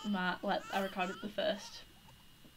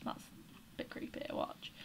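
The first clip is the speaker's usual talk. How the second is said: whisper; 9 dB quieter than usual. Real, not acted. Whispering while Sims woohoo.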